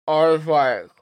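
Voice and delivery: tongue held, words blurred; holding tongue with fingers. Shown as a demonstration. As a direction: speak with fingers pinching your tongue. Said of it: "It almost sounded like you said Affleck".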